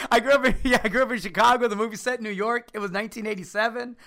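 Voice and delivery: loud, fast, high rant; excited. Real, not acted. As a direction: rattle off facts indignantly. Joking rapid defensive answer.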